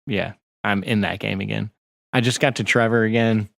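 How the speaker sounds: normal talking voice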